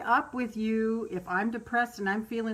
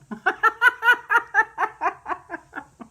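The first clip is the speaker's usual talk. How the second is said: high, loud cackling laugh; burst of laughter; 12 semitones higher, 7 dB louder than usual. Real, not acted. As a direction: break into helpless laughter. Laughing at her swearing.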